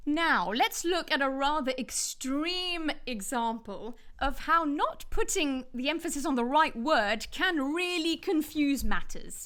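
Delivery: normal talking voice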